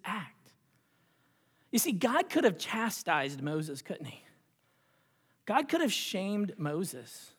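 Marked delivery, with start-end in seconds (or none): none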